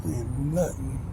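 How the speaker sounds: breathy whisper. Acted. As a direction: whisper it close to the mic. Whispered tag line.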